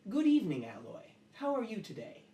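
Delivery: lifted, formal greeting; Lalo, courteous greeting. Acted. Host bowing to the metal creature, courteous. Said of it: "he bows to it and goes".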